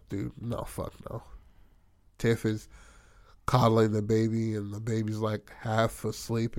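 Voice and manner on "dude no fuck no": quiet, breathy no; hushed refusal. Retold or imitated. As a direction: whisper it under the breath. Riley refusing.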